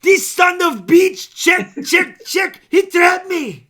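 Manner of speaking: high, loud, slow hammed-up Russian rant; loud mock Russian tantrum; Teddy KGB Russian accent. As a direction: rage like a beaten Russian card shark. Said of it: "Teddy K G B".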